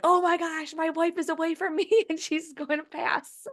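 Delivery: high, panicky imitation of husband; hammed-up frightened husband; mocking him as a panicked husband.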